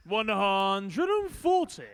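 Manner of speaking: long drawn-out score call; darts caller. Acted. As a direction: stretch the call out, booming.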